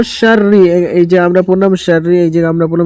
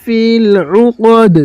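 slow, higher melodic tajweed chant; Quran recitation; 3 semitones higher, much slower than usual. Shown as a demonstration. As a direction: recite it slowly, stretching the vowels. Teacher recites verse lines. (ধীর সুরেলা তিলাওয়াত)

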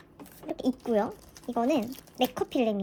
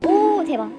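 loud, high burst of amazement; amazed; 7 semitones higher, 8 dB louder, slower than usual. Real, not acted. Fan amazed the photos show up, amazed.

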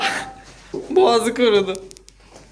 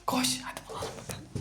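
hushed, quick whisper; 17 dB quieter, faster than usual. Real, not acted. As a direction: whisper so the hiders won't hear. Whispering during hide and seek.

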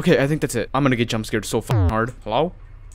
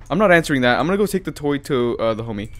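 usual speaking voice

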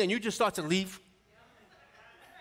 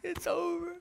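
put-on high, soft sobbing-kid act; mock crying; 8 semitones higher, 5 dB quieter than usual. Boy wailing into his pillow, imitated crying. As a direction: squeak it out through pretend tears.

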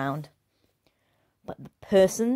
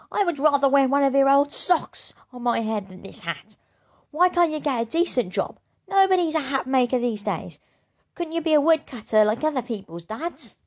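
higher, louder scornful daughter; scornful girl; 7 semitones higher, 3 dB louder than usual. Acted. Rude daughter mocking her father's hats, higher and louder. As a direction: sneer at her father's job. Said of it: "answered the appalling child".

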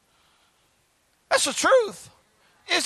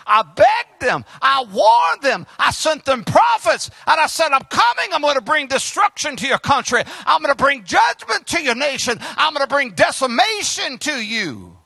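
raised, driving prophetic warning; forceful warning; 4 semitones higher than usual. Acted. God warning the nation of coming judgment, forceful. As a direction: thunder it out as God, higher and insistent. Preacher voices God's angry warning.

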